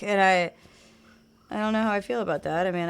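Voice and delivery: normal talking voice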